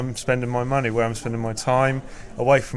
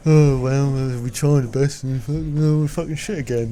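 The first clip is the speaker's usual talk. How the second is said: mock defeatist moan, a touch louder; defeated resigned fan; 3 dB louder than usual. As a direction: shrug it off like a beaten supporter. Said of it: "rather than going".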